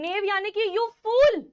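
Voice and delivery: ordinary talk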